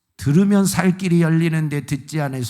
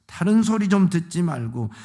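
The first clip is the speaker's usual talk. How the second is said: ordinary talk, nothing marked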